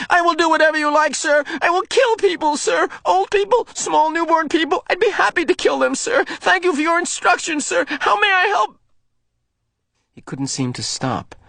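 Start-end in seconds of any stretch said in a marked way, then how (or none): 0.1-8.8 high, fast, mocking servile voice; boy sarcastically aping the obedient speaker; cruel sarcasm. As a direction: spit out mock obedience. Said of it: "mimicked in a cruel, sarcastic voice".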